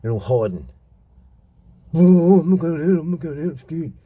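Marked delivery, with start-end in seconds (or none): 1.9-3.9 high, loud whiny coward voice; mocking scared coward. Mocking criminals who hide overseas, high whiny coward voice. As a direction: sneer it like a frightened wimp.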